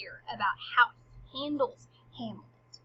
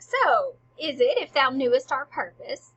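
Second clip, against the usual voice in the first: lightly hammed-up king, loud and deliberate; acting the King; 3 semitones higher, 11 dB louder than usual. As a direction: declaim it firmly, like a stage monarch. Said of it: "And the king's like".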